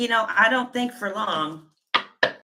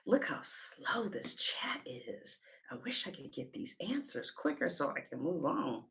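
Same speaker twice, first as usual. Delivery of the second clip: whispered aside; 3 semitones lower, 14 dB quieter than usual. Real, not acted. Muttered aside about the slow chat.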